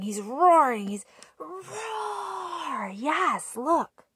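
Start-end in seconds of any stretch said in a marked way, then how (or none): none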